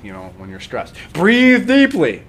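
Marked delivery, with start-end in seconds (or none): 1.1-2.2 much higher and louder, slow, overblown command; mocking common advice. Teacher mocking what stressed people are told, loud and high. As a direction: ham up the usual advice. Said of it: "what are you told".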